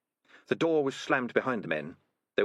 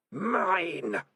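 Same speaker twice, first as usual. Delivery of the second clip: eerie distant voice; a cold voice from inside; voice in the head; 9 semitones higher than usual. A narrator's reading. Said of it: "said a voice in Morris's head".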